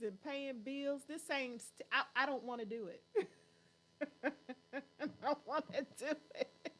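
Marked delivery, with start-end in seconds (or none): 4.2-6.7 soft, slow words broken by laughter; laughing complaint. Real, not acted. Complaining about paying bills, laughing softly. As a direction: giggle helplessly through the complaint.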